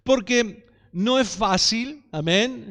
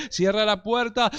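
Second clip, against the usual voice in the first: ordinary talk, nothing marked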